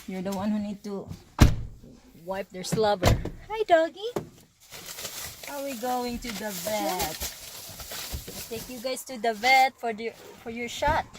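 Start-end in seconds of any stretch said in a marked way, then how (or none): 3.5-7.3 high pet voice. Real, not acted. Greeting the dog.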